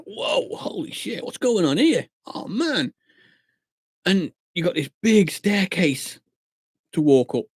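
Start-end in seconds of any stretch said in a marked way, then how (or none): 0.1-2.9 hushed, drawn-out awe, pitched up; retold amazement. Clubber retelling seeing so many women, amazed. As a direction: act out being gobsmacked under your breath. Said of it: "And I'm like".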